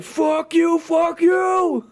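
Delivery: high mock war cry; battle yell. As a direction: scream it like mid-fight, hammed up. Acts out brutal battle shouting.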